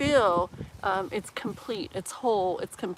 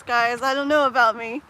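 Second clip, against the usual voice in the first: ordinary delivery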